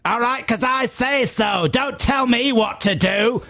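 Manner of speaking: high, drawn-out bossy retort; mock-aggressive high matriarch voice. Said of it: "It got really aggressive really fast".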